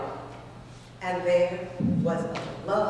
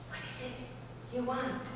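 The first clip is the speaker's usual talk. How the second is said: much softer fish voice; hushed fish; 4 semitones higher, 13 dB quieter, faster than usual. Acted. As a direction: ask it in a hush. Fish asks.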